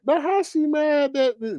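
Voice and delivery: high-pitched mock bafflement; retold disbelief. Retells own puzzled reaction.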